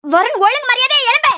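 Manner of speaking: high-pitched, threatening command; angry wake-up order. Acted. Mother warning Varun to get up, angry wake-up order. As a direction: demand that he get up this instant.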